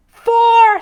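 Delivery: shout. A narrator's reading. Golfer in the crowd shouting a warning, loud yell. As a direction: bellow a warning across the course. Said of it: "shouted someone in the crowd".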